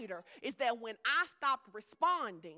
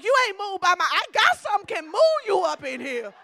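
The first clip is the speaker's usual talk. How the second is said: higher, much louder shouted comeback; defiant shout; 8 semitones higher, 14 dB louder than usual. Retold or imitated. Pastor shouting defiance at her husband's taunts. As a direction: shout it back with a challenge. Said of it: "I like".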